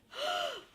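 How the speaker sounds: high, shocked puppet gasp; big gasp. Acted. Grover gasps at the turned page, high gasp.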